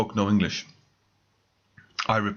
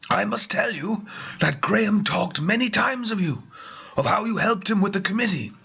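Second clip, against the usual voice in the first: put-on a higher, confiding older gentleman; lightly voiced Archie; 7 semitones higher than usual. Archie confiding to the narrator, lightly voiced conspiratorial tone. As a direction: lean in and confide with a hint of grandeur.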